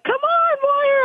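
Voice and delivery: very high, urgent race cheer; excited cheering. Retold or imitated. Trainer reliving cheering her filly on, excited and high. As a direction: urge the horse home in a high cry.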